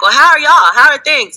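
eagerly fishing for good news; cheery inquiry. Retold or imitated. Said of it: "So then you're like".

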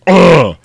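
hammed-up burnt monster groan; mock pained roar. Burned vampire takes his hand away, loud mock groan. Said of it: "He's all burned up".

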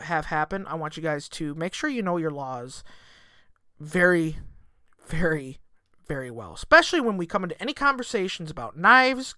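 usual speaking voice

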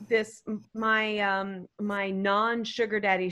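ordinary delivery

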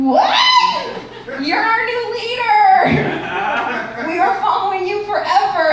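high, loud cry of devotion; imitated awestruck crowd. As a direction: shout like a newly converted follower. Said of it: "And everyone was like".

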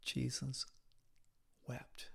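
the preacher's hushed, grave reading; hushed solemn. Real, not acted. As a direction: let "wept" fall away to a hush. Repeated solemn verse.

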